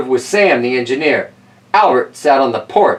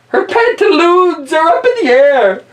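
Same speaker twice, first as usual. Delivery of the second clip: much higher, louder comic voice; silly high aside; 3 dB louder than usual. Acted. Telling the listener about Granny, squealing. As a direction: squeal it for laughs.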